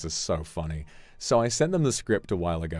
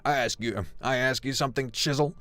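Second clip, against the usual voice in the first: put-on cowboy accent; 6 semitones higher than usual. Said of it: "Those are all pretty authentic c-, cowboy slang terms".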